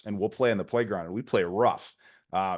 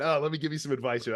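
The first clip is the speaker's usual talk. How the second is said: fast, higher, lightly mocking know-it-all dad; eager advice guy; 6 semitones higher, faster than usual. Dad jumping in to give advice, mock eager and fast. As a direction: ham up a dad itching to advise. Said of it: "We're advice guys".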